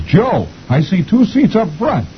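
very high, drawn-out call; excited wife. A narrator's reading. Wife spots seats for husband, high excited call. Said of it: "She says".

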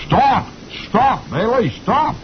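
high mock holler; squeaky cartoon shouts to stop; hollering officers. Officers chasing Beetle, high squeaky mock holler. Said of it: "are hollering".

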